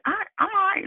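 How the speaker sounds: retold squeaky-high insistence; high-pitched denial. Telling the friend who called her angry she is fine, high mock protest.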